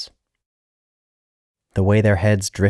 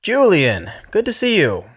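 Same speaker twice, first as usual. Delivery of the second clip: much higher, louder hearty greeting; loud blast of greeting; 14 semitones higher, 3 dB louder than usual. A narrator's reading. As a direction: blast the name out cheerfully. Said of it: "expelled in a loud blast of wind".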